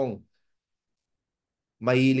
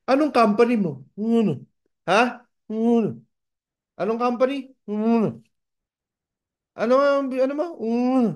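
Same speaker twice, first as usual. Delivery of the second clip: repeated baffled asking; 7 semitones higher than usual. Retold or imitated. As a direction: keep asking, confused. People keep asking, can't hear.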